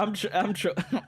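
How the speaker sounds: ordinary talk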